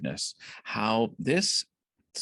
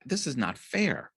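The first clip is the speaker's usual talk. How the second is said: retold sulky protest; 7 semitones higher than usual. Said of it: "he's just really angry".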